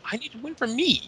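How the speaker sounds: high-pitched mock self-centred wrestler; imitating indignant wrestler. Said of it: "and Ziggler says".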